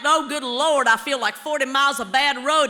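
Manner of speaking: loud, hearty country-lady lament; mock weary Southern sigh of a line. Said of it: "one of them ladies talk like that".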